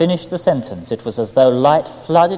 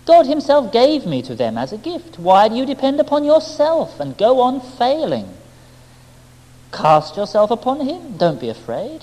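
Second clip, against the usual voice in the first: half persuading, half mocking; 6 semitones higher than usual. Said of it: "she smiled at me, half persuading, half mocking".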